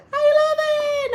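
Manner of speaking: squealed greeting, very high. Real, not acted. Greeting the live chat in a high squeal. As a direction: sing the greeting up high and bright.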